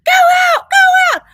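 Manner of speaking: frantic high shout at the bat; shrieking go out at a trapped bat; screaming. Retold or imitated. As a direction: scream it out the window in panic. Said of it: "I'm screaming now".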